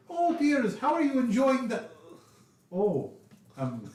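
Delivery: high, doting noblewoman voice; sweet old lady. Acted. Hostess fussing over the sleeping guest, high and sweet.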